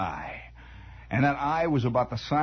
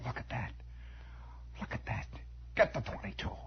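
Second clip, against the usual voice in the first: very soft, almost whispered; barely voiced amazement; hushed awe; 5 semitones lower, 12 dB quieter than usual. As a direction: murmur it in stunned disbelief. Stunned onlookers muttering.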